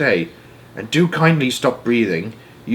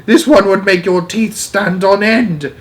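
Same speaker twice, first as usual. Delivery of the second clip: high, louder ghoulish telling; spooky dread; 6 semitones higher, 3 dB louder than usual. A narrator's reading. Giant describes the nightmare to Sophie, high and spooky. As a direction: build the horror.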